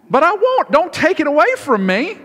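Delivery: high, loud tantrum whine; whiny toddler. Retold or imitated. A tired upset son refusing to give up cake, whining high and loud. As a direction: play a tired kid clinging to his cake. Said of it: "he wanted cake".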